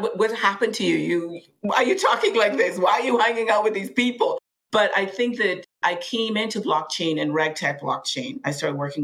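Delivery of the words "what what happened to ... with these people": imitating puzzled doubters, higher pitch; higher-pitched mock questioning of a friend; retold incredulous questioning. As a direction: raise the pitch, mimicking baffled acquaintances. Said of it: "But some people thought".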